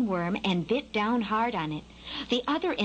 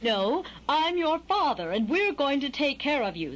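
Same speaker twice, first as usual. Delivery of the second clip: stern; 4 semitones higher than usual. A narrator's reading. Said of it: "the father bird said in a very stern tone".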